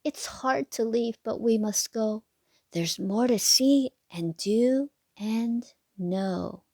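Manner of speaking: put-on lilting sing-song read to little kids; bouncy storybook rhyme voice; reading to children. A narrator's reading. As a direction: read the rhyme like a bedtime picture book. Rhyming picture book read aloud.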